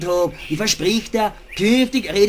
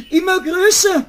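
much higher, louder immer größer; peak alarm; 9 semitones higher, 4 dB louder than usual. Acted. Rabbit panics as the doctors loom larger. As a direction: fear tips into panic. Fear peaks.

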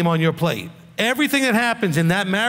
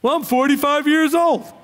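whiny excuse voice; 6 semitones higher than usual. Retold or imitated. Mock excuse from broke man.

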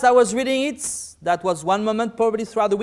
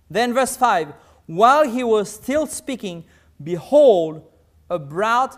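scripture reading; 2 semitones higher, 6 dB louder than usual. Acted.

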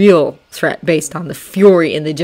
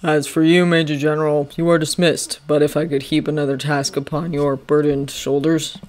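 lower, measured commanding general; General Washington; 3 semitones lower than usual. Acted. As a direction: speak like a calm stern general. Washington voiced in story.

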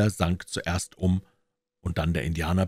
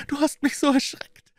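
put-on high, excited child's voice, getting louder and faster; very high little-kid gush, speeding up; cheered little girl; 6 dB louder, faster than usual. A narrator's reading. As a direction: burst out relieved as a small girl. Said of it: "rief die Kleine und lachte hell auf".

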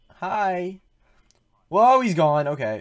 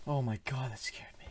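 whispering; 3 semitones lower, 12 dB quieter than usual. Real, not acted. Startled by a jump scare.

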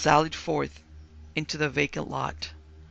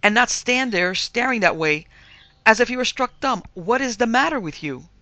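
loud, high-pitched exasperated demand; peremptory scolding; 7 semitones higher, 8 dB louder than usual. A narrator's reading. Mistress shaking the frightened maid, peremptory scolding. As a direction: scold insistently, rising in pitch. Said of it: "who could be peremptory".

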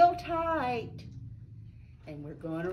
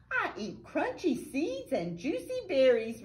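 little mouse; 5 semitones higher than usual. Acted. Reads mouse's line aloud.

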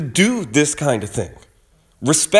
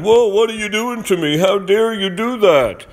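mock indignant protest; 6 semitones higher than usual. Commentator mocking player's outrage at linesman's shove, whiny and high. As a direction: play the wronged victim with fake shock.

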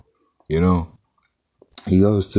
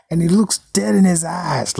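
ordinary talk, nothing marked

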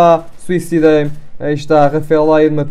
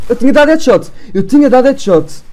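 raised, loud indignant complaint; frustrated outburst; 7 semitones higher, 4 dB louder than usual. Real, not acted. Gamer yells at the screen about his headshot. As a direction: protest the lost kill loudly.

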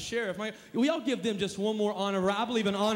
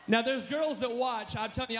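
ordinary delivery

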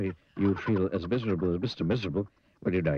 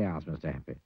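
usual speaking voice

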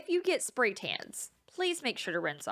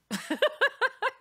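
loud high burst of laughter; bright high laugh; 4 dB louder than usual. Real, not acted. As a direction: crack up at your own joke. Joke about butter.

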